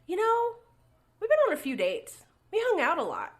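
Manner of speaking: high, loud mock complaint to a friend; animated retelling. Girl recounting to her best friend about no kiss yet, animated.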